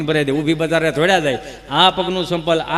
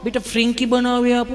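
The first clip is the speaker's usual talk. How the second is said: put-on coaxing sing-out to a little child, slightly raised pitch; doting mother; 5 semitones higher than usual. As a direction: coo like an indulgent mum. (લાડ લડાવતી mummy)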